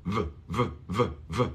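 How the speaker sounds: v buzzing through the lip. Shown as a demonstration. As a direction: let the V vibrate on the lip.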